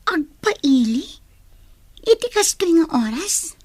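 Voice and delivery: can't believe they'd go at this hour; shocked disbelief. Acted. Girl asking the rebels in disbelief.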